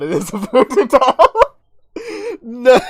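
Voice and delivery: loud, high laughing disbelief; shocked. Real, not acted. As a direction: shriek in delighted shock. Shocked by the talking doll.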